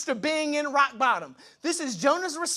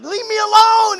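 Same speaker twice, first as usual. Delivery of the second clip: much higher, louder groaning refusal; annoyed brush-off; 11 semitones higher, 12 dB louder than usual. Pretend. Person yelling at the Spirit who wakes them, mock annoyed. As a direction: whine it like a teen told to get up.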